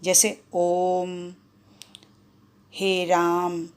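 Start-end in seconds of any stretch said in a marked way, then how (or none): 0.5-1.3 ओम with its vowel held very long and slow; prolonged vowel. Shown as a demonstration. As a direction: draw out ओम to triple length. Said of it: "ह्रस्व स्वर से तीन गुना समय लगता है". (ओम को प्लुत स्वर में खींचकर बोलना)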